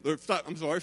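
ordinary talk, nothing marked

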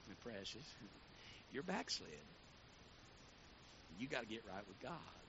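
sweeten it then land the rebuke; syrupy Southern lady. Retold or imitated. Bishop's wife gently scolding a young woman, syrupy soft. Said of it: "she'd syrup you up".

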